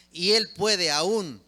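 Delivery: emphatic. Real, not acted. Preacher building his point.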